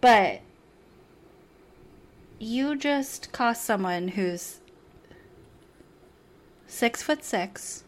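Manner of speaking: ordinary talk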